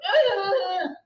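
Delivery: high imitating grunt; mock a player's grunt; tennis grunt. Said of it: "emulate him and imitate him".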